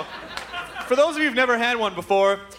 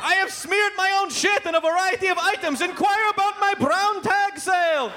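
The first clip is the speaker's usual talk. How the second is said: high-pitched mock madman salesman; hammed-up local TV commercial voice; Crazy Gideon; 9 semitones higher than usual. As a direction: pitch the deals like a deranged appliance dealer. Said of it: "focused on the idea of sanity".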